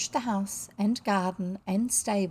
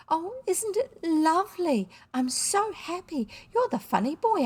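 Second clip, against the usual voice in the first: put-on higher-pitched child bubbling with happiness; delighted child; 8 semitones higher than usual. A narrator's reading. Happy child thanking a boy dressed as Father Christmas, delighted. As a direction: gush like an overjoyed little girl.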